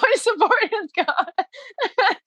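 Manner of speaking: very high, slow mock lament; mock heartbroken wail. To her coach, a slow, high mock wail of loss. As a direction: stretch it out high and pitiful. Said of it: "I was so heartbroken".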